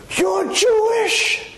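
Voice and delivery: high, very slow, stretched out southern drawl. Retold or imitated.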